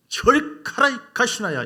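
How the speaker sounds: high, loud Gyeongsang dialect brush-off; Gyeongsang dialect shout. Retold or imitated. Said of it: "이 경상도 말로".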